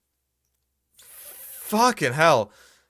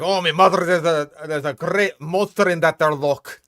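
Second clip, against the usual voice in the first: put-on a lightly done, shaky Scottish accent that slips toward Irish; half-hearted Scottish brogue for a Loch Ness witness; Scottish accent attempt; 2 semitones higher, 3 dB louder than usual. A Loch Ness local in a half-hearted Scottish accent. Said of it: "I gotta warm up my Scottish accent".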